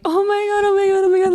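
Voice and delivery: high, gushing excitement; rising-energy excited squeal; thrilled reaction. Retold or imitated. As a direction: act out delight at big news, pitched high. Retelling reaction to proposal news.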